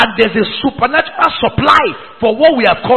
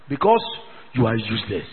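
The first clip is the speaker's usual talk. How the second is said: dropped very low and much softer; hushed rebuke; 14 semitones lower, 14 dB quieter than usual. Real, not acted. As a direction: drop the voice for the cutting verdict. Rebuke to leaders.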